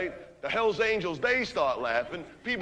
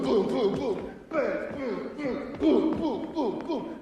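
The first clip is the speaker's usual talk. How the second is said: higher, rapid punch noises; punch sound effects; 6 semitones higher, much slower than usual. Retold or imitated. Narrator acting out a friend punching a biker, punchy booms. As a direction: pop out each boom like a landing fist.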